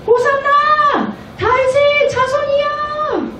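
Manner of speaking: very high, drawn-out slow cry of praise; children praising in the temple, high and slow; joyful children's cry. Retold or imitated. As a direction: ring it out like children shouting hosanna.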